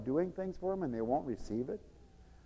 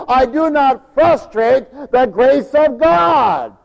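loud, slow, ringing sermon delivery; preaching; 7 semitones higher, 8 dB louder, slower than usual. Acted. Preacher blessing the congregation, loud and ringing. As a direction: proclaim it like a revival preacher.

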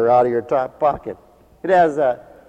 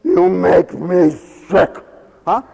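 slow, louder, dragged out insult; hammed-up rude volunteer; mock disgust; 3 semitones higher, 5 dB louder, much slower than usual. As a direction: spit it out slowly in scorn.

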